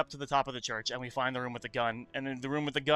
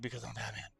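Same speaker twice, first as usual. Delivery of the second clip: Batman voice; 2 semitones lower, 10 dB quieter, faster than usual. Retold or imitated. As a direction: say it gravely as the caped crusader. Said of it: "the Batman voice".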